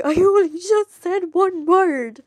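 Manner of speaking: high, loud, drawn-out protest; mock exasperated cry. Friends to the one laughing at anything, mock exasperated. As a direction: exclaim slowly in hammed-up disbelief.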